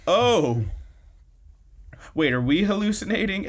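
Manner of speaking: high-pitched mock astonishment; freaking out. Friends seeing Hina float in the air, mock freak-out. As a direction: play stunned disbelief, voice jumping up. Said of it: "they're, like, freaking out now".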